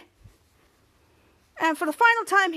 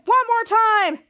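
higher-pitched eager call; 6 semitones higher, 4 dB louder, slower than usual. Retold or imitated. Character calls for an encore.

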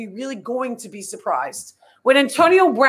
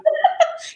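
breathy laugh. Real, not acted. Laughs after thanking Joy.

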